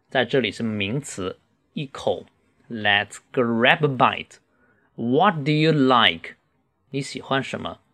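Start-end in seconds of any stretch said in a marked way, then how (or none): 2.7-6.3 slow, deliberate teaching pace; drawn-out model for learners; slow reading. Shown as a demonstration. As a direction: say it slowly, word by word. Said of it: "慢读一次".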